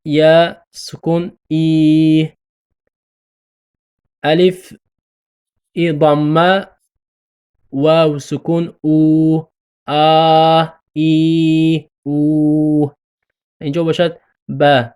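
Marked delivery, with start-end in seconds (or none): 1.5-2.3 ای stretched into a long "ee". Shown as a demonstration. Teacher demonstrating the long ya vowel, drawn out. 8.8-12.9 آ، ای، او each held long; long vowels. Shown as a demonstration. Teacher drilling the three long vowels, stretched.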